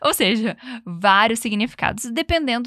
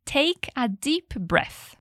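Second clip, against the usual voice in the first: very slow model; much slower than usual. Shown as a demonstration. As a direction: model each word slowly for repetition. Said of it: "vamos repetir a expressão toda". (bem devagar e suave, palavra por palavra)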